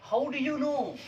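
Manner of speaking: high, lively model answer; teacher's model answer. Shown as a demonstration. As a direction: announce the answer brightly to the class. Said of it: "अब ये आपका ये हो गया ना". (ऊँची आवाज़ में उत्तर बताना)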